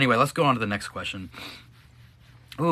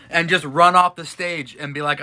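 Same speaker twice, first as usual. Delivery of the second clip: normal talking voice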